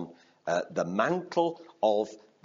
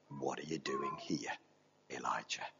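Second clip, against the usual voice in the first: soft, hushed question; gentle whisper; 10 semitones lower, 13 dB quieter than usual. Acted. God questioning Elijah in a gentle whisper. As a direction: whisper it like the still small voice.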